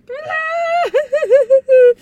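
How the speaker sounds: loud high-pitched fake sobbing; exaggerated stuttering wail, very loud; mock bawling wail. Recapper imitates teenager bawling under the pillows, mock wail. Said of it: "she's just bawling".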